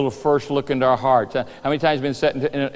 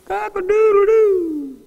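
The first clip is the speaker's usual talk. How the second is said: high, drawn-out rooster call; amateur rooster crow; slower than usual. Acted. Rooster crowing right after Peter's denial, crowing. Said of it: "I'm not very good at rooster calls".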